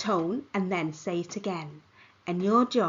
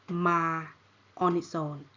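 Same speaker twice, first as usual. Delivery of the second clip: ordinary talk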